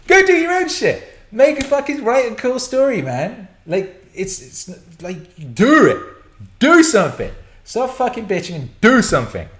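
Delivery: loud, high exasperated yelling; fed-up shouting rant. Real, not acted. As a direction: yell at the whiners to get on with it. Ranting at complainers, yelling.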